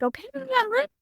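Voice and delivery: bright high call, all set to go; eager. Retold or imitated. Eager teen telling her parents she's ready to go out.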